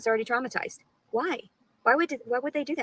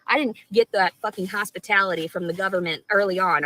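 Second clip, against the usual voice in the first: ordinary delivery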